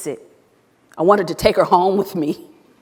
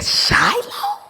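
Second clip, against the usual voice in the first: surprised. Retold or imitated. Teacher meets former student.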